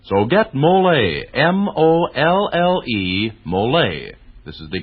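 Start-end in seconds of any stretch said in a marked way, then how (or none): none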